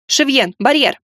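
high, sharp shouted order; sharp command. Acted. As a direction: bark the order.

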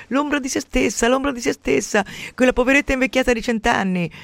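high mock lament of a gossiping old lady; mocking Madame Pelù. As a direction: ham up a pitying gossip. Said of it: "Madame Pelù accorresse ... e dicesse". (imitazione beffarda e acuta di una pettegola)

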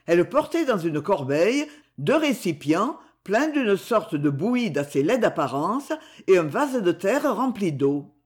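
ordinary delivery

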